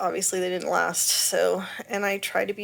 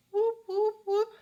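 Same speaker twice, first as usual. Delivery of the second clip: high, squeaky celebratory whoops; playful whoop; 13 semitones higher than usual. Real, not acted. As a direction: cheer it like a party call.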